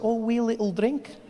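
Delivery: put-on high, lilting Scottish accent, rising at the end; coaxing Scottish. Comic mimics Scots offering a drink, sweetly lilting Scottish. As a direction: make it sound harmless in a Scottish lilt. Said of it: "Scottish people do that so well".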